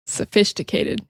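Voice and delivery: "Sophisticated" whispered, fish sound at the start; whispered punchline. Shown as a demonstration. Teacher whispering the pun word to learners. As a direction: breathe "Sophisticated" out as a hushed aside. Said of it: "like the word sophisticated".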